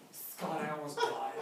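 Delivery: lightly hammed-up overdramatic moan; playing up a small scare as near death; mock dramatic whine. Mocking congregants who overdramatize, a mock dramatic whine. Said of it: "kind of dramatic in that".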